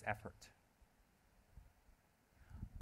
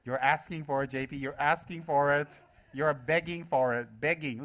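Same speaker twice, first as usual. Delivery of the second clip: mock-accusing, higher and louder; playful teasing; 3 semitones higher, 10 dB louder than usual. Real, not acted. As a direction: tease them loudly, stretching the key words. Teasing an audience member who feigns innocence.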